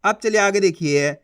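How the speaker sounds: ordinary talk, nothing marked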